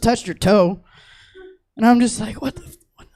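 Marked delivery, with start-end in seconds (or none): 2.4-3.1 whispered in disbelief; stunned whisper. Retold or imitated. Waking mom, whispered disbelief. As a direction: breathe it out amazed.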